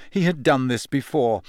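ordinary delivery, nothing marked

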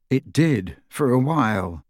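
usual speaking voice

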